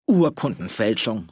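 a slow, dismissive snort at the word Urkundenfälschung; snorting scorn. Acted. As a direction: spit out Urkundenfälschung slowly and with scorn. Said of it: "schnaubte Walter". (verächtlich schnaubend, langsam)

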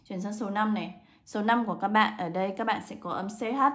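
normal talking voice

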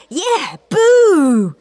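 high, loud jeering yell; yelled boo. A narrator's reading. Dizzy Dave joining the jeers with a loud high yell. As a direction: join the jeering eagerly. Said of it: "yelled Dizzy Dave".

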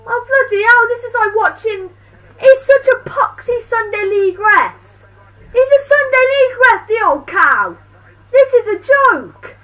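loud, high, half-laughing rant; exasperated rant. Real, not acted. Fan fuming at a Sunday League referee, loud and incredulous. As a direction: rant at the ref in disbelief.

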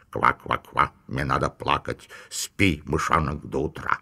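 a toad nanny croaking a pompous lullaby. A narrator's reading. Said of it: "важно квакать".

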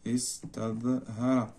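استظهر drawn out softly, syllable by syllable; slow dictation. Shown as a demonstration. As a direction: stretch استظهر slowly and quietly as if writing it. Dictating word while writing it.

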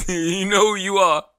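slow, laughing knowing callout; laughing tease. Real, not acted. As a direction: laugh it at your buddy. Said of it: "He know who I'm talking about".

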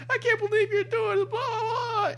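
very high-pitched whimpering wail; mock crying. Driver with a tissue lamenting her ticket, mock high crying. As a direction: bawl it in a squealed fake cry. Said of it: "And she's crying".

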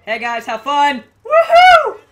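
excited whoop. Real, not acted. Cheering teammates on, loud whoop.

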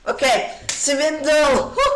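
loud, high, drawn-out cheer; triumphant shout. Real, not acted. Streamer celebrating a swindled win, triumphant shout. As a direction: call it out slowly in triumph.

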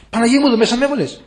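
fast, high-pitched mock plea; retold crying out. Girl in hell calling to the Virgin Mary, imitated outcry. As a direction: cry it out quickly, imitating her protest. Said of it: "Κλάμα, φωνές".

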